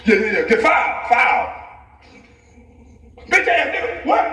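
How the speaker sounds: hammed-up courtside yell; mock foul call shout. Yelling a foul call at Jeremy Lin.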